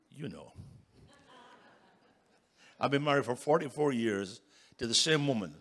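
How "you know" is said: quiet punchline. Retold or imitated. Repeating the punchline to husbands.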